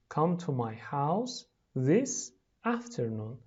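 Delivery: slow, carefully separated words. Shown as a demonstration. Teacher reading for pupils to repeat, slowly. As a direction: say each word slowly and distinctly.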